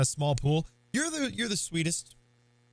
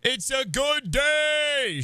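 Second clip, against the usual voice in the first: cheering; 7 semitones higher, 3 dB louder, much slower than usual. Real, not acted. Cheering at a game moment.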